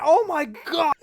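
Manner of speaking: sudden high, loud oh my God; surprised. Real, not acted. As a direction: blurt it out, startled. Catches his own slip mid-sentence.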